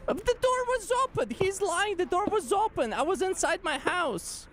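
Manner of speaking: high whiny cartoon complaint; exaggerated cartoon intonation; cartoon character voice.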